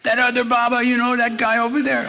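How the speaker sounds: very high, loud mock-innocent squeal; squeaky teasing guru. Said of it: "Maharaji's teasing reply".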